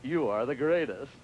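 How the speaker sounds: slow, low gadget voice; talking gadget. Retold or imitated. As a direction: play a little machine praising you, slowly. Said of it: "And it goes".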